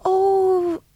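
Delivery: high, airy Donegal lilt; Yvonne's Donegal accent. Retold or imitated. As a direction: let the Donegal vowels float up and down.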